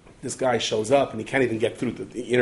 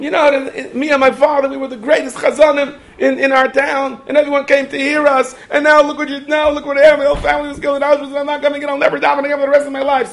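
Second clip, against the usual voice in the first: much higher and much louder, grief turned to anger; anguished outburst; 10 dB louder than usual. Retold or imitated. Former cantor telling the Rav why he won't pray, anguished shouting. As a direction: cry out the loss in a rising wail.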